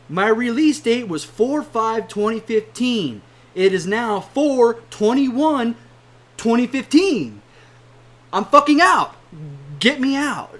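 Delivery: fed up, raising the voice to a shout; angry rant. Real, not acted. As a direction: build to a yelling "I'm fucking out". Ranting about missed release date.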